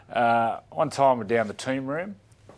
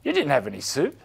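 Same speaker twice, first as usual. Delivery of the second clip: teammate voice; 12 semitones higher, 5 dB louder, faster than usual. Retold or imitated. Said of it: "Ben Kennedy sitting next to him said".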